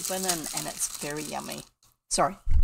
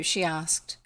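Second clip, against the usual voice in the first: plain reading voice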